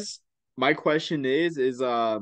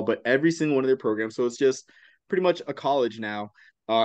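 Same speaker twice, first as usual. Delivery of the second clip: ordinary talk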